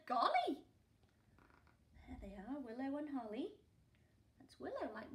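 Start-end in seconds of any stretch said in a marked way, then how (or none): 0.1-0.6 surprised corgi. Acted. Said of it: "the other said".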